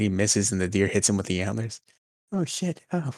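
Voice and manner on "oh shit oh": high, shocked yelp; sudden pained surprise, pitched high; startled. Retold or imitated. Deer hits him with antlers.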